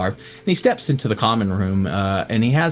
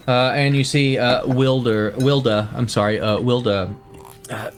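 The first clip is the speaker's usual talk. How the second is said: normal talking voice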